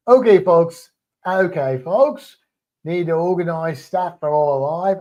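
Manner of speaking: mock Australian folksy call; Australian accent. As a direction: do a broad, playful Australian. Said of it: "how I still picture Australian sounding".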